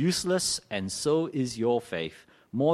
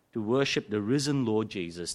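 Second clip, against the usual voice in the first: usual speaking voice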